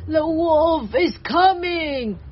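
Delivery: long tearful wail of warning; hammed-up sobbing bear voice; crying bear. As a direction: bawl it slowly as a scared bear. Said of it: "Cried Bear".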